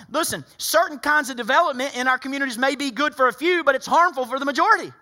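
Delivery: loud, high-pitched preacher's push; fiery preaching. Acted. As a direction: preach it hard to the congregation. Preaching at full force again.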